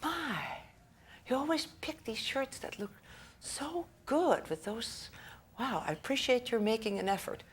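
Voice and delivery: mock-thrilled husband admiring her shirt; delighted husband. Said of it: "And he goes".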